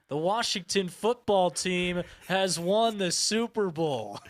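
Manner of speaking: booming sports announcer call; big stadium announcer delivery. Retold or imitated. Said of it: "what's the announcer gonna be like?".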